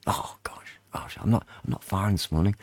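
hushed, near-whispered aside; muttered self-reproach. Real, not acted. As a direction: mutter to yourself after a slip. Muttering after his own slip.

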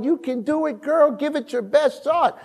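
high, bright cheering for a daughter; rally her on, building to a shout; mother cheering her daughter on over the phone; cheering encouragement. Retold or imitated. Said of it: "Stacy on the phone".

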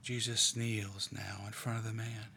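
hushed, half-whispered meditation, leaving long pauses; guided meditation. Acted. Leader guiding a prayerful meditation, in a hushed voice. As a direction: draw listeners quietly into the scene.

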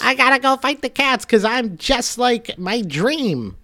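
high, bright-eyed mock hero voice; squeaky little hero sure of himself; high cartoon character voice. Mouse quoting his dream in high voice.

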